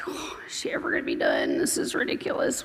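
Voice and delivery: soft mock grumble in a whisper; hushed imitation of complaining kids; whispered imitated complaint. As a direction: mimic the kids under your breath.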